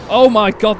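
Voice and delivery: sudden real fright, blurted loudly; startled. Real, not acted. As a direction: jump out of your skin. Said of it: "that made me jump".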